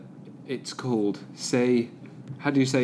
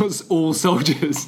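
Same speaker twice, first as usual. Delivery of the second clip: slow, loud, higher mock grunt; mock soldier voice; 6 semitones higher, 6 dB louder, slower than usual. Mocking soldiers to his co-host, loud and slow. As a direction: ham up a meathead soldier.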